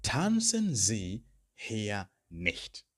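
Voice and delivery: slow, deliberate German command; slow model command. Shown as a demonstration. Teacher modelling a command for learners, slow. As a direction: give the command slowly and clearly. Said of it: "the final one I could use".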